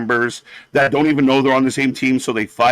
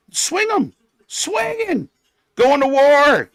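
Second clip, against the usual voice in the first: excited cheering; 11 semitones higher, slower than usual. Real, not acted. Fan hyping the women's brawl on screen, excited yelling. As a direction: fire up the crowd as they swing.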